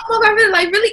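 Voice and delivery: high-pitched, gossipy disbelief; mock astonishment. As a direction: play the avid friend hearing juicy news. Imitates mom listening like a friend.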